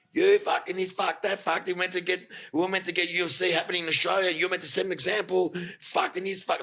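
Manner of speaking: high-pitched imitated angry rant; going right off. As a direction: imitate a boss giving everyone an earful. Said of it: "Like, went right off".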